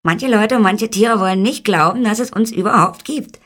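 high, loud, sneering tiny-man voice; scornful little man. A narrator's reading. Little man mocks those who doubt his kind exists, high and scornful. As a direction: jeer at them in a high little voice. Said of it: "sagte die Stimme höhnisch".

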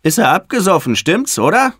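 put-on high, gleeful child's taunt; high, eager teasing question; teasing boy. A narrator's reading. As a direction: needle a little brother. Brother teases about near drowning.

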